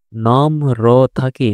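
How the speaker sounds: "নম্র থাকি" read very slowly with নম্র drawn out and each sound clear; slow model reading. Shown as a demonstration. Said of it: "র ফলার জন্য র উচ্চারণ হবে".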